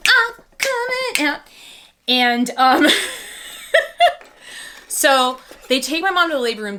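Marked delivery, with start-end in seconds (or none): 0.1-1.4 put-on very high squeaky baby voice. Narrator voicing her unborn self announcing the birth. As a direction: pipe it up like a tiny baby.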